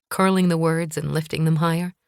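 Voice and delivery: ordinary delivery, nothing marked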